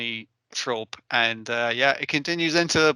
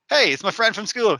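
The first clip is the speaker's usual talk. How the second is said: higher-pitched mock-pleased hello; acted delighted greeting; 6 semitones higher, 4 dB louder than usual. Podcaster voicing Nakatan spotting a school friend, acted delighted greeting. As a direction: call out to a friend just spotted.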